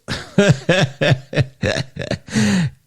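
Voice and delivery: loose run of chuckles; amused, drawn-out laughter; laughing. Real, not acted. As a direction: break into a long laugh. Amused while finding comments.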